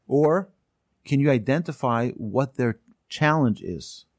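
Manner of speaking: usual speaking voice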